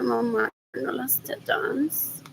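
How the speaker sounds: sudden voiceless whisper. Real, not acted. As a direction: drop to a secret whisper. Whispered aside mid-sentence.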